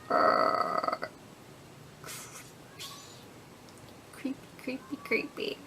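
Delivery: whispering. Retold or imitated. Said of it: "and I'm like".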